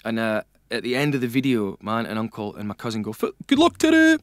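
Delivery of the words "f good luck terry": high-pitched broad Echt send-off; broad Echt accent. Retold or imitated. Aunt, uncle and cousin in a video, imitated broad Echt accent. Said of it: "in this broad Echt accent".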